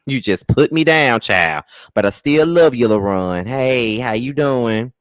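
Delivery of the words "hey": ordinary talk, nothing marked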